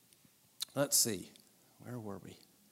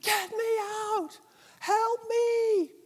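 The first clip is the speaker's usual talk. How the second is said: much higher, drawn-out cry for help; mock desperate scream; much slower than usual. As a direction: shriek it like you're trapped at the bottom of a well. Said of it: "at the bottom of a cistern screaming".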